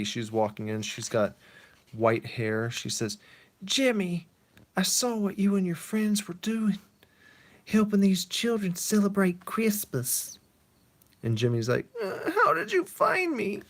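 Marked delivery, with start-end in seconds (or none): 3.6-10.4 put-on old mother voice. Mother tells Jimmy she saw him, old lady voice. 12.0-13.6 put-on very high, startled young man; startled Jimmy. Jimmy asks his mother how she found him, high and startled.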